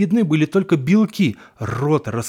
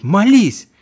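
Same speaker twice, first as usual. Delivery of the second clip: loud, high barked order; shouted command; 7 semitones higher, 8 dB louder than usual. Acted. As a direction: yell the order at her. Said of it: "бабка мне кричит". (громкий высокий крик-приказ)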